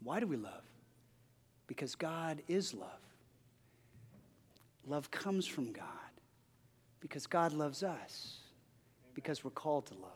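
soft, reverent, slowed declaration; hushed reverence. Real, not acted. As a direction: draw the congregation in close. Hushed sermon climax.

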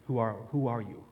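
a hint of the caterpillar, said low and thoughtful; echoing the caterpillar. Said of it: "How do we answer this question?".

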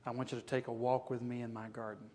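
God softly inviting Job to walk, tender; gentle God. Acted. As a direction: coax him gently into the garden. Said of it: "he just says to Job".

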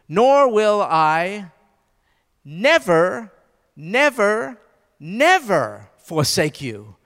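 "Nor will I never, never, never forsake you" slow, every "never" hit in turn; stress on each never. Shown as a demonstration. Preacher spelling out line two, nevers stressed. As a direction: count out the nevers one by one. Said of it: "Line two".

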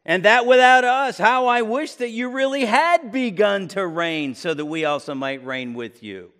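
sarcastic wish, starting high and loud, then dropping. Acted. Paul wishing the Corinthians really reigned, sarcastic. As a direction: exclaim a mock wish, easing down at the end. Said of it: "Sarcasm here".

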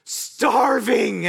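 put-on high, loud whining child; hungry kid. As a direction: play the starving kid begging dad. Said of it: "they'll look at me and say".

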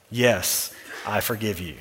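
soft, low "I forgive you"; tender forgiveness. Shown as a demonstration. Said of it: "modeled reply".

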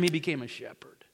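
hushed, breathy whisper on the final reveal; whispered punchline. Acted. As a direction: drop to a whisper to let it land. Dramatic punchline in sermon.

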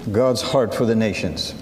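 ordinary talk

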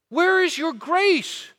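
outraged king, preached at full force; angry king's rebuke. Acted. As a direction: thunder at the servant as the wronged king. King rebukes the unforgiving servant.

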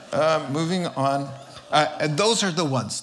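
normal talking voice

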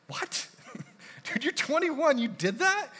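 high, laughing disbelief; amazed laughing. Retold or imitated. Pastor's amazed reply to Noah.

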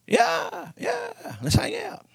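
high-pitched, squeaky hillbilly Peter, overeager. Retold or imitated. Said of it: "he was a redneck".

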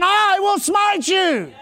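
slow, drawn-out shout; bold declaration. Shown as a demonstration. Preacher shows the church how to answer cancer, slow shout. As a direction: shout it slowly at the sickness like a warrior. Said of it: "You need to say to that depression".

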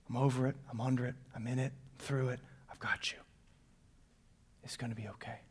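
soft and low, fading to a breath; tender fading to whisper. Acted. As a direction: comfort them, fading out. Voicing God tenderly, fading.

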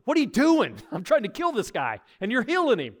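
high, loud, laughing disbelief; exasperated Peter. Retold or imitated. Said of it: "Peter's probably like".

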